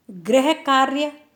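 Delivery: गृह कार्य said slowly with stress on गृह; slow model reading. Shown as a demonstration. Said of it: "slow model reading". (गृह कार्य को धीरे, गृह पर ज़ोर देकर)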